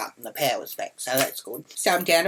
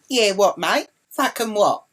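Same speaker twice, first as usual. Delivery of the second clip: loud, higher confrontational jabs; retold aggressive challenge; 6 semitones higher, 6 dB louder than usual. Walking back up to the driver, aggressive challenge. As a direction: square up to him, louder.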